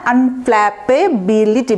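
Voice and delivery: আনফ্ল্যাপেবিলিটি slowly broken into its syllables; teacher's slow, segmented আনফ্ল্যাপেবিলিটি. Shown as a demonstration. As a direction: pronounce আনফ্ল্যাপেবিলিটি piece by piece, slowly. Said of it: "ভেঙে ভেঙে উচ্চারণ করবেন".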